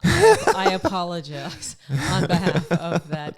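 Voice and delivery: laughing mock apology; cracking up while apologizing. Real, not acted.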